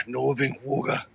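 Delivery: imitating the cab driver's thick northern English accent, slow and soft through a laugh; a quick, laughing northern English cabbie impression; mock northern English accent. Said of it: "The accent was insane".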